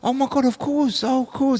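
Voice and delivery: high-pitched gushing girl imitation; young clubbing girls. Young girls fall for the hustler's story, high gushing agreement. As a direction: mimic obliging girls, pitched right up. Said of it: "young girls going to the club".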